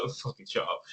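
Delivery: quiet, muttered under the breath; muttered aside. Retold or imitated. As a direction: mutter it to a friend. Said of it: "We were looking at each other like".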